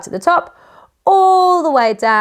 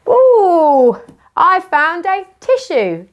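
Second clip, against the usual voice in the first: mock delight; 7 semitones higher, 3 dB louder, slower than usual. Teacher pretends to find tissue.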